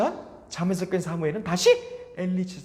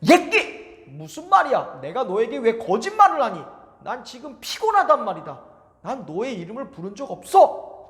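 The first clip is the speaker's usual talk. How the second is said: put-on higher, louder scolding old priest; angry shout; 7 semitones higher, 8 dB louder than usual. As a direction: snap at the boy in anger. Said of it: "소리를 지르며 화를 내면서". (화내며 소리치는 엘리 제사장)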